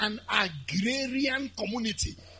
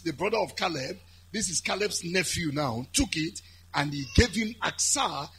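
normal talking voice

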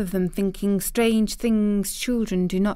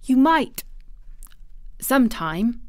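bold; 5 semitones higher than usual. A narrator's reading. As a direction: venture it boldly, with a pause. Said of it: "she said something bold".

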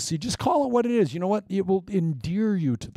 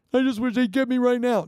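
high, far whining voice; mock the complaining pioneer; whiny listener; 8 semitones higher than usual. Congregant's imagined complaint voiced.

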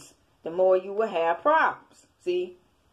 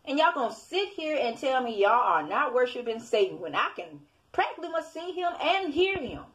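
ordinary talk

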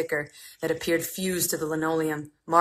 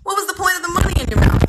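much higher, quick, louder jeering voice; needling customer; 13 semitones higher, 9 dB louder, faster than usual. Acted. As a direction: fire the question fast and high. Another customer needling the boy.

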